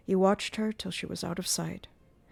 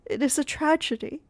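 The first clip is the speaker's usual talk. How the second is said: tragic confession; 8 semitones higher, 5 dB louder than usual. Acted. Christine unmasking before Raoul.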